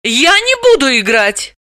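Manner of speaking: high-pitched, protesting refusal; indignant protest. A narrator's reading. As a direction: protest firmly in a high woman's voice. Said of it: "запротестовала очнувшаяся Далила".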